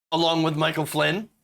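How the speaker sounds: normal talking voice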